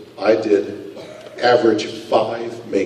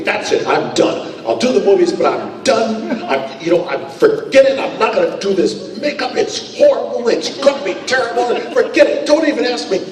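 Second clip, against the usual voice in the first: much higher, exaggerated complaining voice; mock whiny refusal; 12 semitones higher than usual. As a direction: ham up a fed-up actor swearing off the makeup. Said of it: "is that I said".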